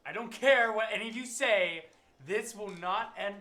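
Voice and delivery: loud and firm, slowing on this will not end; loud emphatic warning to the table; insistent loud protest. Real, not acted. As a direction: put your foot down hard, nearly shouting. Player insists the sword is cursed.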